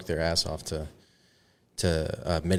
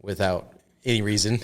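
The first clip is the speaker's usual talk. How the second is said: normal talking voice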